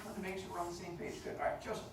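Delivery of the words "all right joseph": normal talking voice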